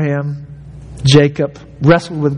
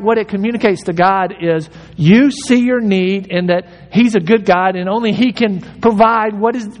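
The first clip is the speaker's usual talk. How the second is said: normal talking voice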